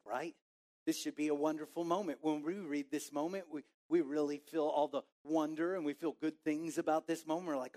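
normal talking voice

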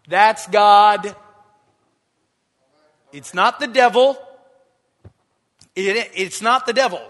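shouted, ringing proclamation; loud preacher's declaration. Acted. Preacher declaring to the church that it is God, loud. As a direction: bang the point home.

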